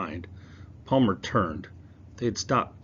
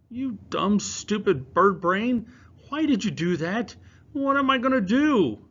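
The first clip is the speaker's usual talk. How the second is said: put-on high, loud exasperated boy; boy yelling at his pet pigeon; frustrated scolding; 12 semitones higher, 7 dB louder than usual. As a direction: berate the bird, then despair.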